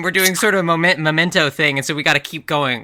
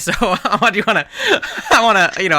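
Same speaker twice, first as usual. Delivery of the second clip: laughing; 6 semitones higher, 3 dB louder than usual. Real, not acted. Laughs heard.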